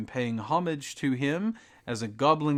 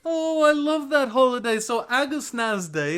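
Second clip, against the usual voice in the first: much higher, louder, thrilled; excited gushing; 12 semitones higher, 8 dB louder than usual. Acted. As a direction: gush with delight. Goblin host gushing over holiday.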